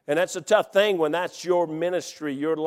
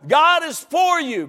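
slow, loud preacher shout; shouted promise; emphatic proclamation; 10 semitones higher, 5 dB louder than usual. Acted. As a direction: drive it home slowly. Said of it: "Look at me like you believe it".

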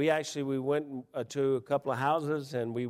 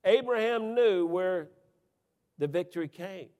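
preaching refrain; 6 semitones higher, 5 dB louder than usual. Acted. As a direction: ring out the refrain.

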